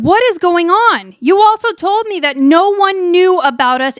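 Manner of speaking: high, exasperated mimicry; mock mad. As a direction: act out him confronting her. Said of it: "he's, he's really mad".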